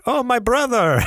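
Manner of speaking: high, loud hammed-up Russian accent; big high-pitched mock-Russian exclamation; bad Russian accent. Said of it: "what kind of an accent was that? ... Russian".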